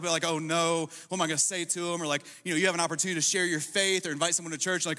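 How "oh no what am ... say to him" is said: usual speaking voice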